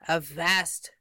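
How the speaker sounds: usual speaking voice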